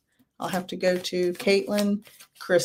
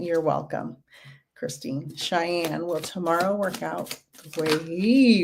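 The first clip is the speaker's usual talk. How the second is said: ordinary talk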